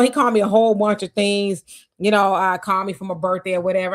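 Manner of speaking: ordinary delivery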